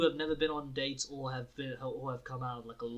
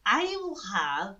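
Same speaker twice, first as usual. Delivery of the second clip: slow, high mock Irish accent; kooky Irish girl; 6 semitones higher, 3 dB louder, much slower than usual. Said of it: "this really kooky Irish girl".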